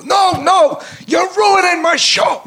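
high, loud mock outburst; Mel Brooks bursting in. Director bursting into rehearsal, high mock yell. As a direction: storm in yelling like the boss. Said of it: "and he'd go".

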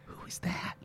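hushed, voiceless mock astonishment; stunned whisper. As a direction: whisper it as if seeing a stranger. Acts out stunned reaction to accent.

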